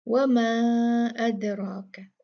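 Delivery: वमा with the आ drawn out long before अदराक; slow recitation of वमा अदराक; four-count madd. Shown as a demonstration. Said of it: "इसी तरह की मद है".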